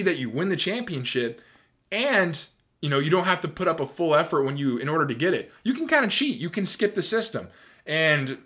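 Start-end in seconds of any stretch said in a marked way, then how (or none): 5.6-7.5 high, lilting sarcasm; mock permission. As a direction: mockingly coax in a much higher voice. Sarcastically voicing permission to cheat.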